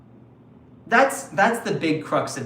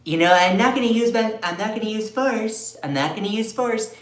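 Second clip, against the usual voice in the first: mock Trump; 5 semitones higher than usual. Vlogger imitating Trump on Greenland, raised mock voice. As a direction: play a blustering Trump. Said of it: "he was like".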